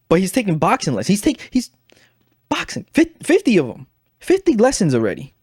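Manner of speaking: can't believe it, rising up high and tight; exasperated. Real, not acted. Venting to viewers about the friend's boxing lessons, real exasperation climbing up high. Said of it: "I'm getting frustrated now".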